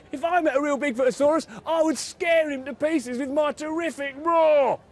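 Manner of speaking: Tiny boasting to friends, fast high bellow. A narrator's reading. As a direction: bellow it proudly like a tiny tough guy. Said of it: "bellowed Tiny".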